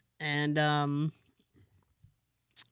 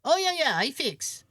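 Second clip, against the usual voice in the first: lightly mimicked handyman in broken English; eager-to-agree handyman, starting high; imitating Louis; 6 semitones higher than usual. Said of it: "this is what he said to me".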